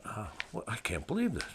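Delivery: quiet, dazed muttering to himself; soft disbelieving mutter; muttered thought. Retold or imitated. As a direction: say it under your breath, stunned. Inner thought while beaten.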